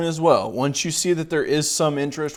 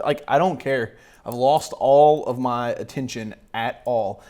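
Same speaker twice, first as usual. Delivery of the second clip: normal talking voice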